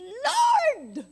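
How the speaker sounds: sudden loud cry of Lord. Retold or imitated. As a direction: cry out to God in panic. Child crying out in story. (sigaw na umiiyak)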